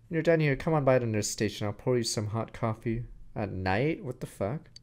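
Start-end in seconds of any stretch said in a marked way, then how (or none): none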